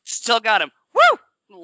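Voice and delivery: high, loud celebration ending in a whoop; gleeful whoop. Real, not acted. Host celebrates leftover freebies, high whooping glee.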